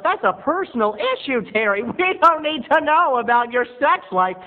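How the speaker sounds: imagined viewer scolding Terry, high and loud; annoyed viewer. Pretend. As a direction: nag him like a fed-up viewer. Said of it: "But you're saying to yourself".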